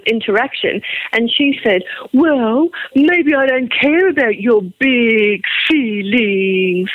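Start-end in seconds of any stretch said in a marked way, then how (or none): 2.1-6.9 slow, drawn-out mock petulance; retold sneering put-down; mocking childish retort. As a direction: drag each word out like a sulky child. Quoting a couple's spiteful exchange.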